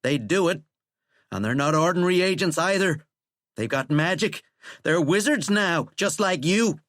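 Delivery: angry threat. A narrator's reading. President warning that his agents are wizards, angry. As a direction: press the threat harder, rising.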